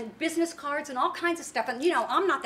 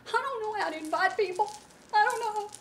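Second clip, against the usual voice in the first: scared; 8 semitones higher, 4 dB louder than usual. Retold or imitated. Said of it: "she was just scared".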